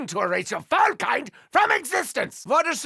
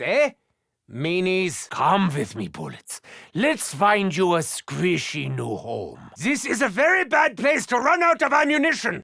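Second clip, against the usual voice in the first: ordinary talk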